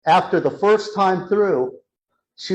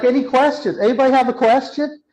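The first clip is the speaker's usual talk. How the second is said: high, quick, eager call for questions; perky, raised presenter voice; smiling presenter; 9 semitones higher, 3 dB louder, faster than usual. Retold or imitated. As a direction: imitate a beaming trainee inviting the audience. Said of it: "everything's smiling".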